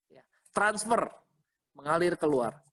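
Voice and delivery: normal talking voice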